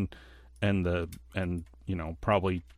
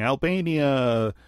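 mock yelling; 4 semitones higher, 4 dB louder, slower than usual. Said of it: "They're yelling".

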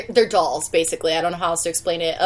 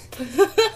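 high giggle; 12 semitones higher than usual. Real, not acted. Laughs at own joke.

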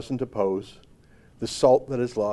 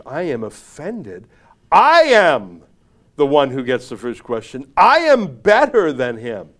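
haughty, building to loud bursts on "I am"; mock indignation; 10 dB louder than usual. Host voicing the offended columnist, pompous mock indignation. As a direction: puff it up with offended self-importance.

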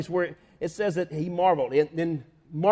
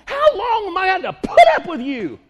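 loud, higher, laughing exasperation; exasperated Jesus; 10 semitones higher, 7 dB louder than usual. Retold or imitated. Jesus telling the father off, loud. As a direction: let him have it, fed up. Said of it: "looked at him and said".

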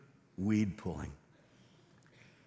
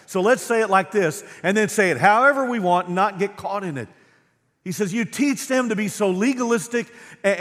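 loud, high, driving sermon delivery; preaching at full force; 10 semitones higher, 10 dB louder than usual. Acted. Pastor to congregation, voicing Jesus' woes, preaching at full force.

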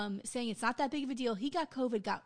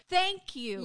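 loud high thank you; mock the anchor's heartfelt thanks; quoting Anderson Cooper; 6 semitones higher, 4 dB louder than usual. Host retelling Anderson Cooper thanking the board member. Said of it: "and he says to her".

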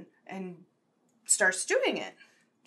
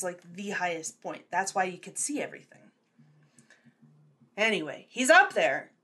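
usual speaking voice

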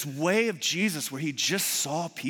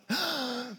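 high, sharp intake of breath; theatrical gasp; 8 semitones higher, 5 dB quieter than usual. Retold or imitated. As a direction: gasp as if thrilled to spot someone. Teacher spots the late child.

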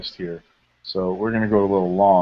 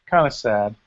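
ordinary talk

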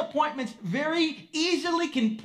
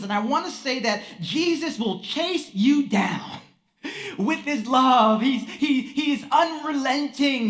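louder rising preaching, building then easing off; preaching at full force; 3 dB louder than usual. Acted. Preacher urging the congregation about relentless love, full preaching fervor. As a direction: drive it home to the pews.

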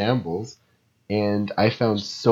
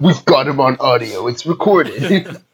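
mock cop voice; 6 semitones higher, 6 dB louder than usual. Mock raid officer voice.

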